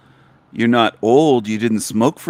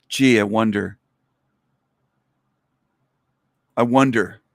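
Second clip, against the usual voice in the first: sarcastic. Real, not acted. Sarcastic about copied ideas.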